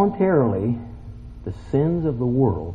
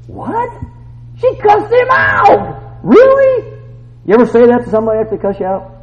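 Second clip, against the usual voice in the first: high-pitched, loud disbelief; incredulous outburst; 11 semitones higher, 6 dB louder than usual. Real, not acted. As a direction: shout it in amazement to the crowd. Preacher reacts in amazement to story.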